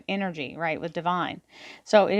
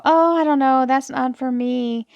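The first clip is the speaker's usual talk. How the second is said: mock reluctance; 5 semitones higher, 5 dB louder than usual. Reader voicing a client's first reaction, mock reluctance. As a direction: imitate someone turning it down, pitched up and louder. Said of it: "you may immediately go".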